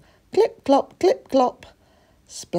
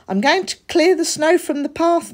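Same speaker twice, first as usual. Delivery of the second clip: high keen little cat voice; young cat offering to clear snow; eager kid cat; 11 semitones higher, 4 dB louder than usual. A narrator's reading. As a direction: rush to tell mum the plan. Splat announcing chores.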